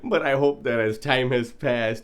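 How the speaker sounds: can barely get the letter out for laughing; laughing through it. Real, not acted. Reading his prank love letter aloud, cracking up.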